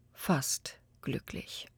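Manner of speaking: slow, soft, almost unvoiced; sad whisper. A narrator's reading. Narrator doubts the tree's happiness, slow sad whisper. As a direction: let the word fade into a sigh.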